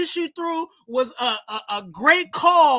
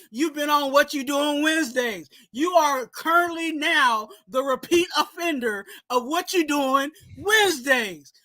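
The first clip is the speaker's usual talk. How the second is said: ordinary delivery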